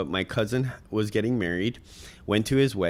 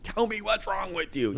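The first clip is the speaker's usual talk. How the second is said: mock angry demand; 11 semitones higher than usual. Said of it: "I just wanna shake the crap out of her".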